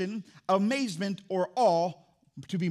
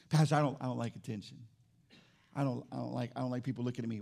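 mock shy mumble; 8 semitones lower, 6 dB quieter, faster than usual. Shy congregant voiced quietly.

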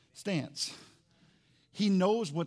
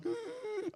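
sharp high gasp for air; out-of-breath gasp; 7 dB quieter than usual. Retold or imitated. Preacher acts out being winded by boxing, gasping. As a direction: gasp like you can't breathe. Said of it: "I'm huffing".

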